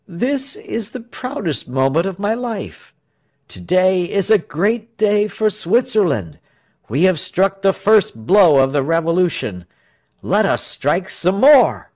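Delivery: high, building public speech; rousing oratory. A narrator's reading. Tell stirring Swiss to revolution, rousing oratory.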